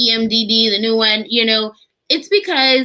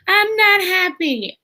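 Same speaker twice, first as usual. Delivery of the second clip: put-on high, slow, pouty child voice; little son sulking; 8 semitones higher, slower than usual. Mother voicing her son, slow and pouty. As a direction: whine it like a sulky little boy.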